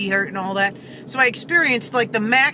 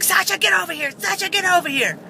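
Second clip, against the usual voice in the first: retold yelling at dog; 8 semitones higher than usual. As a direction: imitate a woman calling her dog back, high and sharp. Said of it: "she starts screaming at the dog".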